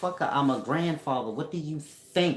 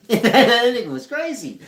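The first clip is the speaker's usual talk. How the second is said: laughing; 11 semitones higher, 14 dB louder than usual. Real, not acted. Cracking up at the memory.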